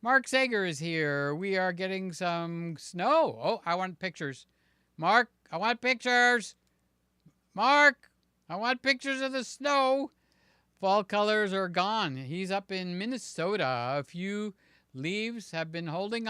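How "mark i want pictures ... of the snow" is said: high, loud mock demand; playful demand. Streamer demanding snow pictures from a viewer, playfully insistent. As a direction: call out for it in a raised, insistent voice.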